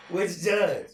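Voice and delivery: normal talking voice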